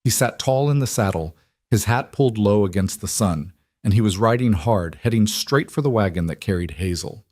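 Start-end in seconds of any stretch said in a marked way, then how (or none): none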